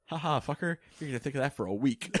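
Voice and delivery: teasing imitation of a smug friend; mock gloating. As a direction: crow it like a prankster who has won. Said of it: "and you're like".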